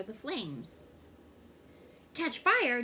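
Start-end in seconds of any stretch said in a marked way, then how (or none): 2.1-2.8 sudden high alarmed gasp of the words. A narrator's reading. Jessica, frightened at class safety talk, alarmed outburst. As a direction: blurt it out in fright, higher and louder.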